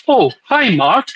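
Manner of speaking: put-on high, swooping The Room accent; swooping quote in the accent from The Room. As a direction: mimic the movie line in The Room accent. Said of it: "in the accent. If you've seen the movie The Room".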